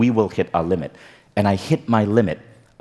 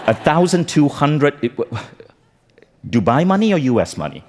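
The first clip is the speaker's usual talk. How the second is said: mildly acted sticker shock, pitched up; incredulous; 5 semitones higher, 3 dB louder than usual. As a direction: balk at the price, playing up disbelief. Retold shock at a price.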